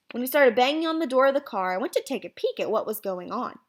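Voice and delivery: ordinary delivery, nothing marked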